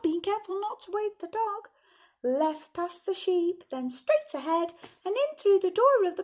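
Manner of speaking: high-pitched storybook ladybird; little ladybird voice. A narrator's reading. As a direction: pipe it out like a tiny ladybird raising the alarm. Ladybird urgently tells the animals.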